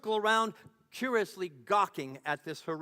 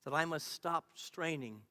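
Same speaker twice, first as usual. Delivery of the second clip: normal talking voice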